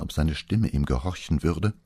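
plain reading voice

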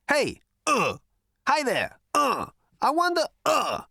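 mock small talk. Zaphod mimicking his talk with robot captors, mock sheepish. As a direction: ham up an awkward attempt to chat.

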